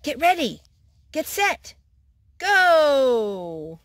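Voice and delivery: high, loud countdown yell. A narrator's reading. Raccoon starting the kite run, loud yell. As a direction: yell it so the whole yard hears. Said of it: "Raccoon yelled so everyone could hear".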